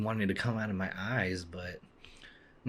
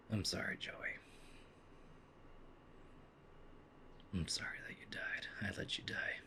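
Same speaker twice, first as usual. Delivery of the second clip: whispered guilty apology; hushed, guilty whisper; sad whisper; 2 semitones lower, 16 dB quieter than usual. Real, not acted. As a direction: whisper it to the lost friend. Mourning dead game character.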